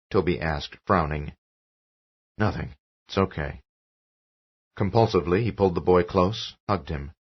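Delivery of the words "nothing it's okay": softer low comfort; quiet reassurance. A narrator's reading. Father reassuring his son, quiet reassurance. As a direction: settle the boy.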